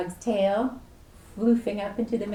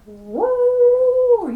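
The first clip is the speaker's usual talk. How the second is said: a sudden whoop, pitched way up and loud; loud high whoop; 10 dB louder than usual. Acted. Reader voices the sleeping dog with a loud high whoop.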